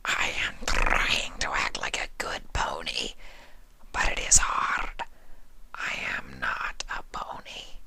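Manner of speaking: low, hushed breathy confession; whispered changeling. A narrator's reading. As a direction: whisper the truth. Sympathy confesses.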